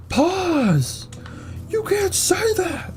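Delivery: squeaky, very high cartoon yelp; slow, stretched mock scold in a tiny high voice; meme voice. Joking to viewers after saying edging, squeaky high mock scold.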